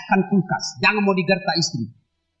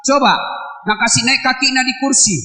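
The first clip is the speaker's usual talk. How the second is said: ordinary delivery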